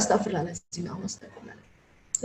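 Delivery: breathy whispered prayer; whispered istighfar. Shown as a demonstration. Parent holding back anger, whispering istighfar. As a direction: whisper it to calm yourself down. Calming oneself before anger.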